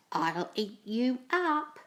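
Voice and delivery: ordinary delivery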